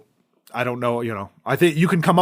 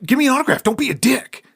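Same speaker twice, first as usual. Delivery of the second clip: much higher, louder mock demand; mock yell; 12 semitones higher, 6 dB louder than usual. Rude fan yelling at the actor for an autograph, mock shout. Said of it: "yelling at him".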